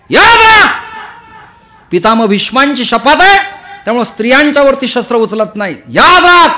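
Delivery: high, full-force warrior threat; thunderous battle cry, very loud and high; Arjun's lion roar. Acted. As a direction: roar a furious warning. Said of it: "सिंहनाद करणारा अर्जुन".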